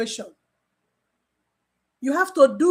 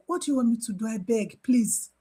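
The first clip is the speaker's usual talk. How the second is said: hushed, gentle begging, much softer; soft pleading; 3 semitones lower, 5 dB quieter than usual. Retold or imitated. Nurse softly begging a tense patient to calm, hushed pleading. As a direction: coax them quietly, almost begging.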